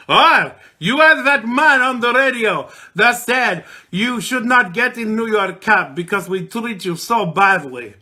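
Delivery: mock the New York cab driver's accent; put-on cab driver accent. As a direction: vague foreign accent, loud and brash. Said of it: "I don't know where that action, accent was from".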